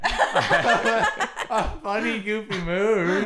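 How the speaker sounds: mocking echo of funny goofy mood, laughing; laughing slow parody of funny goofy mood; laughing through it. Real, not acted. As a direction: repeat her words in a slow, laughing tease.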